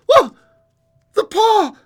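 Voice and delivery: high, loud frantic shriek; wild cry. A narrator's reading. Wife wakes husband with sudden idea, crying wildly. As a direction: cry out wildly, pitch soaring. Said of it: "she cried wildly".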